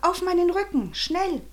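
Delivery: high, hurried-sounding urging; pressing, high-pitched command; urgent order. A narrator's reading. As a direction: urge a friend on, pitched right up. Boy urging friend to climb on.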